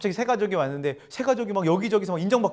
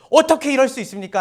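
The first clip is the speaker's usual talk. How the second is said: 따지는 항의; 6 semitones higher, 9 dB louder than usual. Retold or imitated. 일꾼들의 불평이 쏟아지는 장면.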